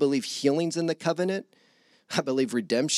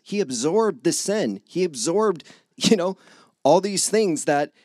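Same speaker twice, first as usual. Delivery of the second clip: preaching; 4 semitones higher, 4 dB louder than usual. Acted. Pastor driving the point home.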